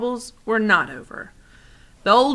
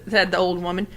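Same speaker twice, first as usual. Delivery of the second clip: ordinary delivery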